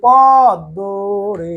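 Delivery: পদ্ম stretched as "paw-ddo"; drawn-out demo. Shown as a demonstration. Teacher showing the "o" sound, পদ্ম drawn out. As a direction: draw out both vowels of পদ্ম, ending round "o". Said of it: "পদ্ম উচ্চারণ করার সময় ও উচ্চারণ করি".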